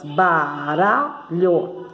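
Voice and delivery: BARALHO slowly split as ba-ra-lho; Baralho, each syllable stretched and separate. Shown as a demonstration. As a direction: sound out Baralho in three drawn-out syllables. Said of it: "tem três sílabas".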